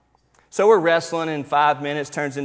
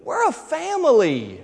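put-on much higher, slow child's plea with a laugh; little girl voice; 14 semitones higher, slower than usual. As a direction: imitate the two-year-old pleading sweetly. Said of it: "And she stops and says".

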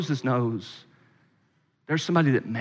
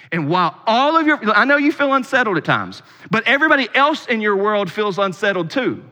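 raised, pressing pulpit voice; impassioned preaching; 7 semitones higher, 5 dB louder than usual. Real, not acted. Preacher to congregation who feel unsettled at times, impassioned preaching. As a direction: press it on the listeners, then come down slightly.

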